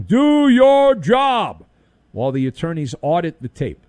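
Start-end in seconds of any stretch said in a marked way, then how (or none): 0.0-1.6 slow, much higher and louder "Do your job"; mock yell. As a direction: bark it like a coach on the sideline. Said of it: "someone yelling".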